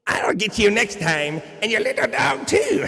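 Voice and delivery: high, slow cackling witch threat, rising to a squeal. Acted. Host hams up the wicked witch for the chat, high and slow.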